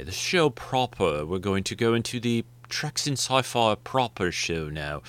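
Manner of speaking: slow, posh-ish put-on British accent; a half-hearted British accent, stretched out; mock British accent. Shown as a demonstration. Host mocking his own show, in a lightly faked British accent. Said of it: "my terrible accent".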